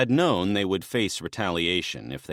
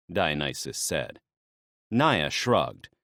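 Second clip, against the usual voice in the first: usual speaking voice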